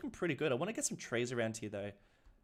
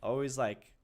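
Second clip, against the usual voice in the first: usual speaking voice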